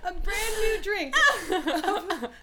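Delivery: slow words squeezed out through laughter; laughing. Real, not acted. Laughing while telling mishap.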